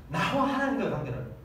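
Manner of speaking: normal talking voice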